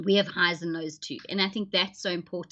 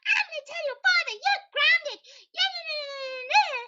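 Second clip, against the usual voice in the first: much higher, outraged scolding mother; indignant mom. Retold or imitated. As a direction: scold the kid in mock outrage. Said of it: "And you're like".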